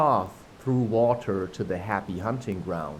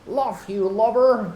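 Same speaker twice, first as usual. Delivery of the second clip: much higher, odd put-on Irish sailor; second Irish try; 11 semitones higher, 3 dB louder than usual. Smee yelling at the other pirate, a failed Irish voice. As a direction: give the Irish accent one more go, pitched high. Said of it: "I cannot do Irish".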